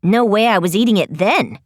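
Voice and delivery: low, quick retort; low Lizzie voice. A narrator's reading. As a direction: drop down and fire it back.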